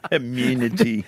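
put-on South African accent, low and drawn out. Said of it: "can we hear your South African accent again".

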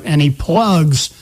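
ordinary delivery

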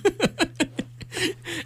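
high, soft laugh; real laughing. Real, not acted. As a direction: laugh in spite of yourself. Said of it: "you're laughing now".

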